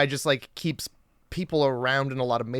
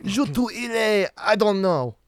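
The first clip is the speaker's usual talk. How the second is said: put-on French accent; 5 semitones higher than usual. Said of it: "in a French accent".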